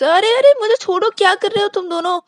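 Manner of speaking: high, loud yelp of alarm; startled protest. Acted. Vikas protests as friends drag him up, high startled yell. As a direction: shout in surprise as they grab you.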